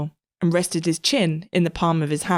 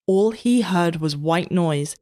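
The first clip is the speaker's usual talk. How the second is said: plain reading voice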